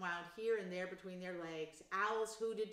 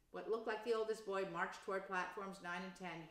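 plain reading voice

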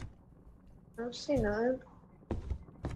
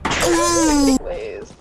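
a loud, high desperate plea; loud begging please; 4 semitones higher, 5 dB louder than usual. Real, not acted. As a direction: shout the plea at the game. Begging the game for gas.

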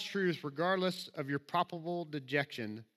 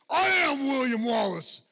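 slow, higher and louder heroic movie line with a southern twang; Braveheart impression; 7 semitones higher, 5 dB louder, slower than usual. Preacher quoting Braveheart to his congregation, a southern-accented heroic impression. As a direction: declaim it like William Wallace in a southern-accented imitation. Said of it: "my southern imitations of other accents".

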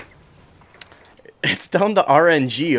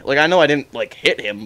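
ordinary delivery

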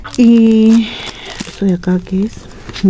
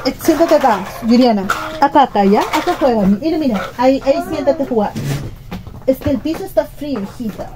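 soft to her daughter; 4 semitones higher, 5 dB quieter than usual. Real, not acted. Mother settling toddler while filming.

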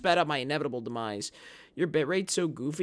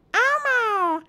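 much higher, louder puppet squeal; squeaky Elmo shout; 7 dB louder than usual. Acted. Streamer to chat, squeaky Elmo cry.

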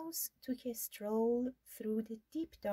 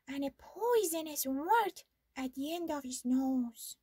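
higher mouse voice, relishing each detail; mouse describing monster; 6 semitones higher than usual. Acted. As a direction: paint the beast to scare owl.

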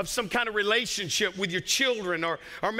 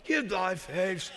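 put-on slow, solemn old-English declaiming; a touch of hammed-up scripture voice; King James reading. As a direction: proclaim it like the old Bible. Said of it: "He said".

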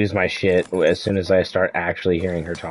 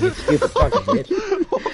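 much higher, slow, laughing as he insults; slower than usual. Real, not acted. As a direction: crack up while calling her stupid.

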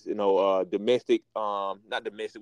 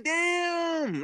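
drawn-out "Damn" said much higher in awe; impressed "damn". Retold or imitated. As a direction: let the awe slip out as you remember him. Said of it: "I'm like".

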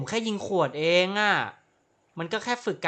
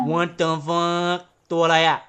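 slow, stretched out in shock; startled drawl; 5 dB louder, much slower than usual. Real, not acted. Gamer spots a strange creature, stretched-out startled drawl. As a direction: let the shock drag the words. Creature suddenly appears in game.

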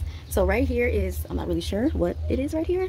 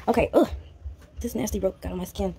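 normal talking voice

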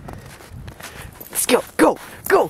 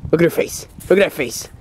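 normal talking voice

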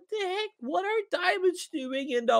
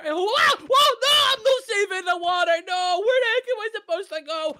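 high, loud panicked yells; panicked shouting; 6 semitones higher, 5 dB louder than usual. Real, not acted. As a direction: shout while fleeing. Chased by monster.

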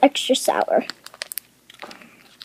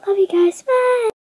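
cheerful sign-off; 9 semitones higher than usual. Real, not acted. Vlog sign-off.

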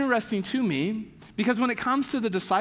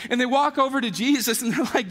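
ordinary talk

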